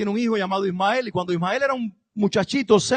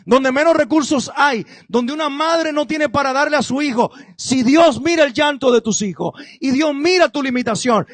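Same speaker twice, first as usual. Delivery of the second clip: full-force preaching, louder; predicando con fuerza; 5 semitones higher, 7 dB louder than usual. Acted. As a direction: proclaim God's care over the crowd. (predicación vehemente)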